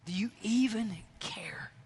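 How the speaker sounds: breathy whisper, hurt and pleading; whispered plea. Retold or imitated. Preacher echoing the disciples' cry, a whispered plea. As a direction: whisper it like a doubting question to God.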